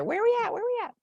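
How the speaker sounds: high, sing-song where we at; high teasing "Where we at?"; mock texting call. Group texting, high mock query.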